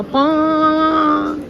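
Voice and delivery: long drawn-out horn honk; a slow stretched honk; imitated horn. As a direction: mimic a train horn. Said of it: "green horn बजेगा".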